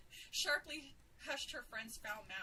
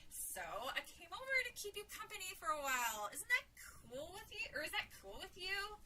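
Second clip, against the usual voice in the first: put-on teenage friend humoring a child; 7 semitones higher than usual. A narrator's reading.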